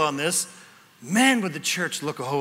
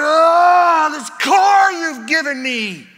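much higher, much louder wail, drawn out; mock lament to God; 10 semitones higher, 9 dB louder, much slower than usual. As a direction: cry out at heaven over the car, hammed-up. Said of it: "I find myself going".

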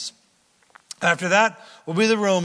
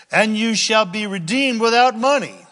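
raised, emphatic scripture reading; 5 semitones higher, 6 dB louder than usual. Acted. Preacher reading scripture to his congregation, emphatic. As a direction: proclaim the verse to the congregation.

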